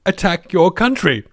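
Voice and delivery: polite, desperate Muffley. Retold or imitated. President telling the Soviet premier about Ripper, mock polite and desperate. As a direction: break bad news gingerly, pausing before the worst. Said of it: "really polite and desperate not to inflame things".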